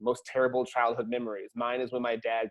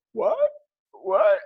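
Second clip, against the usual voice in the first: baffled; 8 semitones higher, 6 dB louder than usual. Retold or imitated. Kids baffled by dad's prank.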